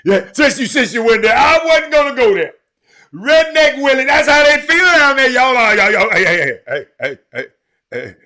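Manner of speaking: high and loud, hollering with glee; hyped shout. Real, not acted. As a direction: yell it out, thrilled with yourself. Host cracking up over his skit.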